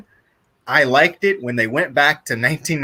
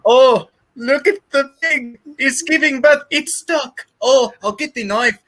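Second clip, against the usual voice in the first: mock movie character; 10 semitones higher than usual. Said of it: "I was like".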